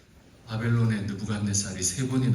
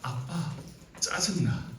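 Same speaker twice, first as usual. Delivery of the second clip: first take of the line; 3 semitones higher, 5 dB quieter, slower than usual. Shown as a demonstration. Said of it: "다섯 글자예요".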